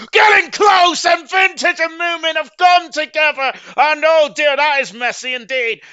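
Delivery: loud, high, slow crash call; excited shout. Real, not acted. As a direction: shout as the cars collide. Crash unfolds during live commentary.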